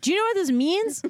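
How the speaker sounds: hammed-up indignation; mock outrage. Mom ranting at her baby.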